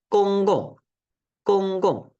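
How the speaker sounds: gong gong: first high level, second sharply falling; first then fourth tone. Shown as a demonstration. Teacher demonstrates tone contrast in gong gong. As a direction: contrast the two tones of gong gong. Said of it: "el segundo es cuarto tono".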